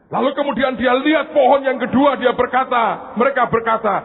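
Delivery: high, loud pulpit storytelling; preaching at full force. Acted. Preacher telling of the second tree, loud and high. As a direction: drive the story hard at the listeners.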